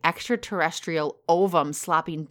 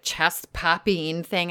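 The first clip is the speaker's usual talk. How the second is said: ordinary talk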